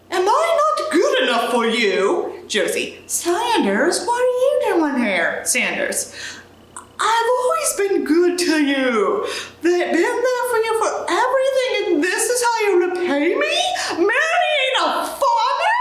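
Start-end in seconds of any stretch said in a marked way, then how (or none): 0.1-2.4 hammy British lament, laughing through it; British accent. Acted. Reader voicing drunk Sanders in British accent. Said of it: "I can't do a British accent". 3.1-5.4 higher, startled British lady. Acted. Reader voicing Josie surprised at Sanders. Josie voiced in play script. 7.0-15.8 high, laughing mock-British rant. Reader voicing heartbroken Sanders in British accent. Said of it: "I can't do a British accent".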